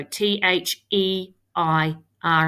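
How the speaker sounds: T H E I R spelled slowly letter by letter; spelling out letters. Shown as a demonstration. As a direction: spell T H E I R one letter at a time. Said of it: "There we go".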